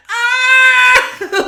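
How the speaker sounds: very high screaming ah; losing it at the dinner table, shrieking; frantic scream. Retold or imitated. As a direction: scream like losing your mind. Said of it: "Like losing my fucking mind".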